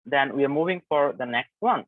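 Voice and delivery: ordinary talk, nothing marked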